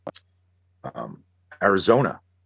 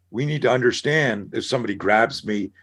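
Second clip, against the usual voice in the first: ordinary talk, nothing marked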